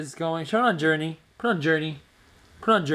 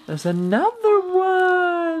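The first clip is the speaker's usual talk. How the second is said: very high, slow coo; baby talk; 4 dB louder, much slower than usual. Acted. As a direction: stretch it out for the baby. Parent cooing to baby.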